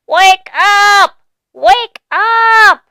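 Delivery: high, loud squeaky yell; mouse yelling. A narrator's reading. Mouse yelling into donkey's ear, high and loud. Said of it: "and yelled".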